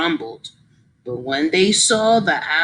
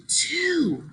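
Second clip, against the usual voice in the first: whisper; 5 semitones higher, 4 dB quieter than usual. Shown as a demonstration. Teacher answers in a whisper.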